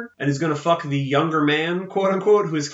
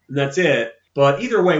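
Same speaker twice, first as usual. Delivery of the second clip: ordinary delivery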